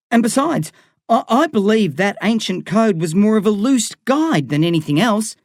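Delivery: nervous reasoning. A narrator's reading.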